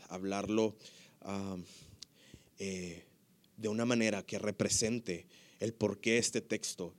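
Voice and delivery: low, soft spoken prayer; preacher's quiet prayer before explaining the text. Real, not acted. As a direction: pray softly and humbly.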